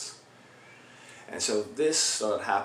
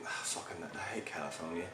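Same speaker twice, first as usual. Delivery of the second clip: whispered curse to himself; muttered aside; 5 semitones lower, 10 dB quieter than usual. Acted. Singer forgetting the tour city, cursing in a whisper. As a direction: hiss it under your breath, soft and low.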